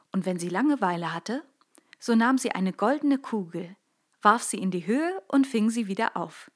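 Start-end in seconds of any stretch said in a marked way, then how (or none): none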